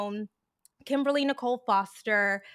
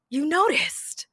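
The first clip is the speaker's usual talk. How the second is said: higher, taken-aback question at normal volume; mock shock; 6 semitones higher than usual. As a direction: act startled but keep it contained. Shocked reply, before the louder repeat.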